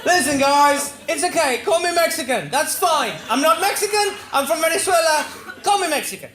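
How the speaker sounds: high, loud, insistent plea; high-pitched comic exasperation; exasperated protest. Acted. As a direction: protest loudly to the whole room.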